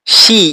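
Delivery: শি called out high and loud; sharp drilled শি; model pronunciation. Shown as a demonstration. Teacher models শি for Bangla learners. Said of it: "শি মানে চার".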